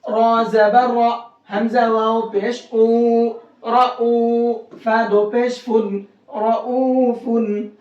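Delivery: slow syllable-by-syllable recitation with heavy "रॉ"; practice spelling. Shown as a demonstration. Teacher practising mid-word Ra, slow spelling recitation. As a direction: stretch each syllable, hold "रऊफुन" long. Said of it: "उसको अब हम practice से समझते हैं".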